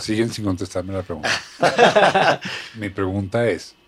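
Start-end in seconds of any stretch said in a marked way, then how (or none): 1.3-2.7 a burst of high laughter. Real, not acted. Host laughing at his guests for dodging, loud laugh.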